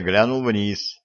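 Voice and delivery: plain reading voice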